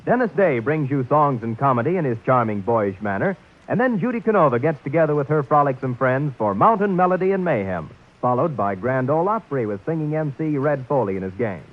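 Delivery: bright radio announcer read; network promo. Acted. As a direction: sell the evening like an ad.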